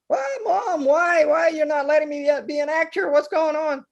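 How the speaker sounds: put-on high, pleading child's voice; upset kid pleading. Son protesting to his mom about losing his acting. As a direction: whine why like a hurt boy. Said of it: "clears throat".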